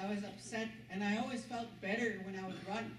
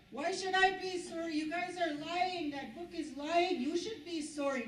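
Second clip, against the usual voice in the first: defiant retort; 7 semitones higher, 5 dB louder than usual. Retold or imitated.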